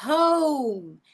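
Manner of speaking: "Home" drawn out, o curving into u, closing on m; long O curve. Shown as a demonstration. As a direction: let "Home" glide downward slowly. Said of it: "você faz essa curva".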